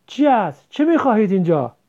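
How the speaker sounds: loud, irritated demand; impatient. A narrator's reading. Headman's wife to children at her door, impatient. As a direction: ask as if pestered.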